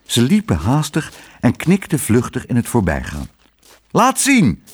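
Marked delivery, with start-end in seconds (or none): 3.9-4.6 hoge, luide roep; schreeuwend. A narrator's reading. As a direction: roep het nieuwsgierig naar de kok. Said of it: "schreeuwde meneer Pardoes". (Pardoes schreeuwt naar de langslopende kok)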